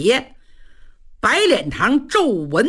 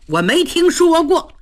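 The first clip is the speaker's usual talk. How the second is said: scoffing; 6 semitones higher than usual. A narrator's reading. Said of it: "雷大彪气乐了".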